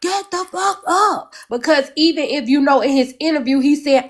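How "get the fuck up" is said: high, drawn-out imitation of a yell; mock yell. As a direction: stretch out a yell at a high pitch without real volume. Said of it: "he was the one that was yelling".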